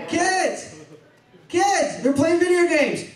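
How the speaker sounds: high-pitched call through the house; calling the kids. Acted. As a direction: sing out to children in another room. Checking on family before sneaking off.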